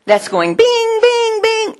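sing-song high bings like a flasher; high beacon bings. Retold or imitated. Preacher showing his light at the office, high bings. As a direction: chime them out like a little light.

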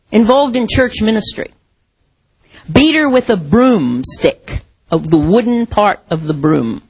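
usual speaking voice

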